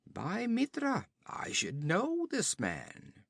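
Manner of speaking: hoch einsetzen, dann langsam nachdenklich; sinnender Hauptmann. Acted. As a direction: Olgerd erkennt Conan, langsam und hoch. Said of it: "this man spoke".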